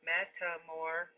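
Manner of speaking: "Metamor-" slowly in separate syllables, left open. Shown as a demonstration. Teacher leads pupils to the next piece, "Metamor-" syllable by syllable. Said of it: "do our syllables for metamorphosis".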